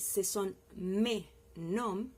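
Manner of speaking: "mes" stressed, slow and clear; very slow model sentence, "mes" marked; teacher dictating "mes noms". Shown as a demonstration. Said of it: "es apellidos en plural".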